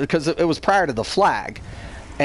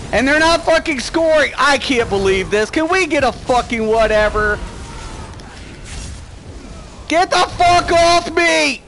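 raging shout; 10 semitones higher than usual. Real, not acted. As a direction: scream at attackers to get off. Being attacked, raging.

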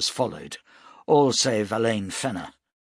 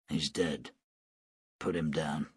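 grim quiet; 6 semitones lower, 8 dB quieter than usual. A narrator's reading. Shano grim over body.

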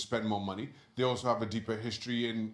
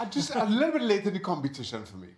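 laughing through it; 8 semitones higher, 5 dB louder, faster than usual. Real, not acted. Laughing while making his point.

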